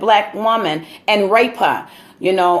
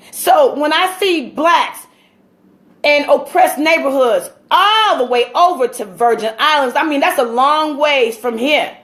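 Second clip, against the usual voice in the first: impassioned preaching; 6 semitones higher than usual. Acted. Speaker preaching to his listeners about slavery, fervent and rising. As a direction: build it like a sermon from the pulpit. Resumes preaching after aside.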